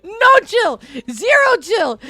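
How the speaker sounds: high, loud exclamation; real high-pitched disbelief; amused outrage. Real, not acted. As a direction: cry it out, thoroughly tickled. Listener cracking up, exclaiming.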